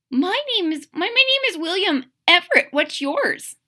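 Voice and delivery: put-on high, stammering child voice; shy young crocodile. Little William answers the bird shyly, high stammering child. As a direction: stumble over it like a shy kid.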